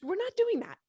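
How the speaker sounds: high, loud incredulous retort; family scoffing. Retold or imitated. Family refusing to close windows, mock incredulous shout. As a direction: scoff at her like she's crazy. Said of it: "My family's like".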